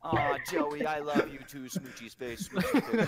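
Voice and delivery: high, lovey-dovey teasing breaking into laughter; mock gushing affection. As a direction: coo at a friend, pitch climbing into a laugh. Gushing teasingly at friend Joey.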